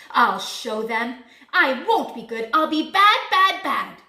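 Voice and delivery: loud, emphatic outburst; angry shouting. A narrator's reading. Big Bad Wolf vowing to be bad, angry shouting. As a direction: shout a stormy vow, hitting each word. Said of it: "shouted Big Bad Wolf".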